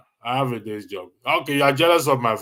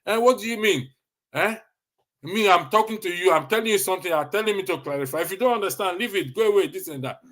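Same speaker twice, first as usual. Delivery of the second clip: higher, indignant mock outburst; mock-offended rant, pitched up; offended retort; 7 semitones higher than usual. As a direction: act out a Nigerian taking offence at being asked. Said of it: "Some people consider clarification as insult".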